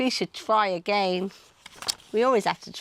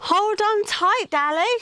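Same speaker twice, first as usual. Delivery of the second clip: shout; 11 semitones higher, 4 dB louder than usual. Acted. Sid warns Dally as the wave hits, urgent shout. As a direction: call over the crashing wave. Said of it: "shouted Sid".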